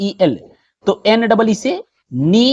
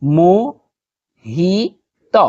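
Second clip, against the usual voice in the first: teacher dragging out the name; drawn out slowly; much slower than usual. Shown as a demonstration. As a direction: stretch Mohit out very slowly. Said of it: "यानी मोहित".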